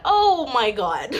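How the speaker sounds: retold yelp. Retelling the first contraction, mock yelp. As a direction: imitate your own yelp, stretched and amused. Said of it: "I let out a yelp".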